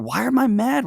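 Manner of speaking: high, exasperated question to self; acted self-questioning. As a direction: ask yourself in a high, worked-up voice. Acts out questioning own anger.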